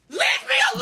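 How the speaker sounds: high, furious yell; angry shout. Real, not acted. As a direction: scream it out in anger. Crying rant, shouting.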